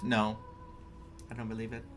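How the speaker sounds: ordinary delivery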